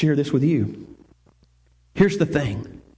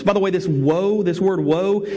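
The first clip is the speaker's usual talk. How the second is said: ordinary talk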